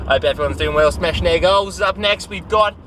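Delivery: a bad Australian accent as a cheesy evening radio host; terrible Aussie accent. A mock Aussie DJ hosting his pretend radio show, in a badly faked Australian accent. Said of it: "Very terrible Aussie accent".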